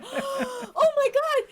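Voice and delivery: high, loud, drawn-out shock; mock-horrified high cry; shocked gasp. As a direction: gasp it out, aghast. Imitates bartender's shocked reaction.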